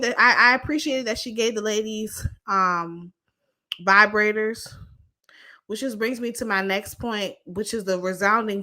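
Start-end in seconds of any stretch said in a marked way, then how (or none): none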